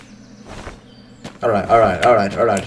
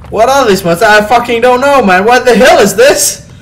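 loud, raised voice of mounting panic; alarmed; 6 semitones higher, 6 dB louder than usual. Real, not acted. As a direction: demand answers in rising alarm. Alarmed by monsters.

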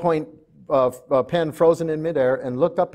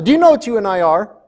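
quick, high, loud yell; yelled question at full volume; retold shout; 8 semitones higher, 11 dB louder, faster than usual. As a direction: shout the question, imitating an agitated mom. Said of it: "she shouted to Pamela".

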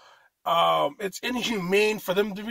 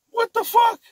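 incredulous outburst; 4 dB louder than usual. Real, not acted. Ranting at people in shirt masks.

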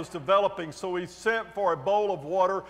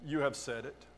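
quiet reply; 4 semitones lower, 9 dB quieter than usual. A narrator's reading. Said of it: "Jesus replied".

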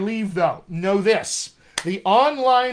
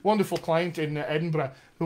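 usual speaking voice

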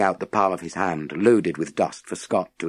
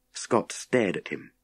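usual speaking voice

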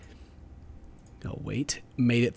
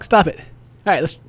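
ordinary delivery

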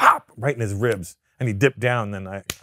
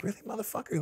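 mock challenge in head; 11 semitones higher, 12 dB quieter, faster than usual. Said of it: "So I'm like, in my head".

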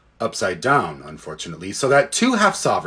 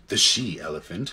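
usual speaking voice

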